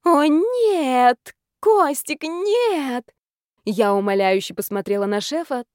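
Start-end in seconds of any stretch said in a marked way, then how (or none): 0.0-3.1 high, loud, drawn-out wailing; mock passionate cries. A narrator's reading. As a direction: cry out in pretend ecstasy. Faked passion for eavesdroppers.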